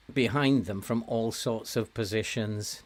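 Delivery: ordinary talk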